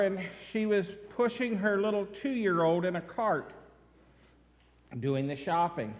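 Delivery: ordinary talk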